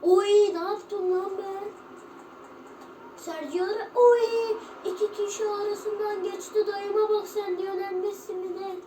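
loud, high, hyped-up shouting; excited yelling. Real, not acted. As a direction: yell in disbelief at the play. Wild reaction to in-game play. (heyecanla bağırarak)

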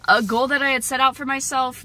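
normal talking voice

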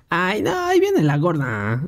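voz aguda de niño burlón; burla de niños. Retold or imitated. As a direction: se burla del compañero. Compañeros burlándose en la escuela. (niño burlón en la escuela)

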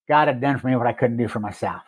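ordinary talk

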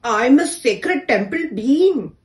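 loud, lofty boast; proud boast. A narrator's reading. She-elephant boasting to the door, proud and lofty. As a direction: puff up with pride. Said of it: "she proudly did boast".

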